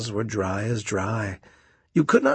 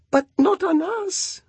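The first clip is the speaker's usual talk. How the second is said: very high, alarmed oyster voices; frightened oyster voices. Acted. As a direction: squeak in alarm. Oysters cry out.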